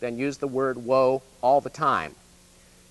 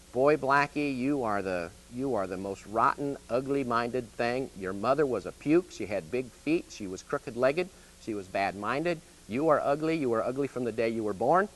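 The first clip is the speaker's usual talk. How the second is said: sweet-talking insults in a softer, lower voice; soothing sweet talk, softer; 3 semitones lower, 4 dB quieter than usual. Shown as a demonstration. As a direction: coo the words to the horse like praise. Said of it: "when you talk soft and soothing".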